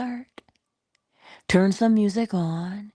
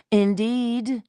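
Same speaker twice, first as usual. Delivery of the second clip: higher drawn-out indeed; mock grand narrator; 6 semitones higher than usual. Imitating the great narrators, a mock grand indeed. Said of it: "mock posh narrator, higher".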